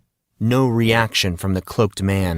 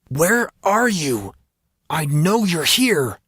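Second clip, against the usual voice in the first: slow, much higher, defiant call into the silence; 8 semitones higher than usual. Acted. Monson calls to a hidden attacker, slow and much higher. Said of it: "Monson swore aloud".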